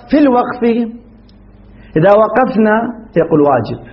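ordinary talk, nothing marked

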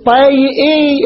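طيئي stretched slowly for the class; the teacher draws out طيئي slowly; drawn out. Shown as a demonstration. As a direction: spell out طيئي syllable by syllable. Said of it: "عرفت كيف صار؟".

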